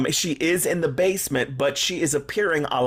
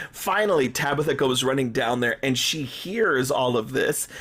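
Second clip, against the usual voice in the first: usual speaking voice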